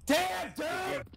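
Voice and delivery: yelling. Real, not acted. Gamer yells and friends tell him to stop yelling. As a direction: blast it out at the mic. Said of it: "Please stop yelling".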